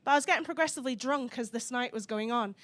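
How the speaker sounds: ordinary talk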